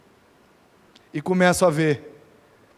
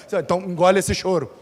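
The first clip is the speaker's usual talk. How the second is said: ordinary delivery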